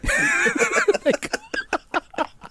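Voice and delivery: high, wheezing laughing fit; long squealing laugh; helpless laughter. Real, not acted. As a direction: crack up at your own foolishness. Cracks up admitting they got caught.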